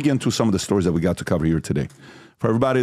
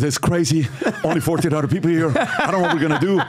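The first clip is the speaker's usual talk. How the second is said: laughing, higher and panicky; mock panic; 7 semitones higher than usual. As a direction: laugh through a mock panic about the crowd.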